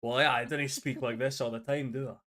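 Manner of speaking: broad Glaswegian accent, played up; full Glaswegian. Shown as a demonstration. Scottish player showing off full Glaswegian to friends. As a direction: lay on the Glasgow accent thick. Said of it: "This is me when I go full on Glaswegian".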